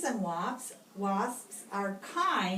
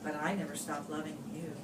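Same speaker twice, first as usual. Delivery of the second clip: softer, lower and fast; tender aside; 7 semitones lower, 8 dB quieter, much faster than usual. Acted. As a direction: say it gently like a secret love note. Tender closing line of poem.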